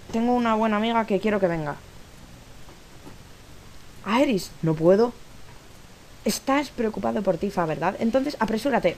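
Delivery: a hint of acting both heroes; lightly voiced character lines. Reads game dialogue aloud.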